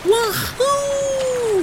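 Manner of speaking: high, long-held whoop; joyful shout. A narrator's reading. Jardad sliding down the waterfalls, shouting for joy. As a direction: let out a gleeful, soaring holler. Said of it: "he shouted".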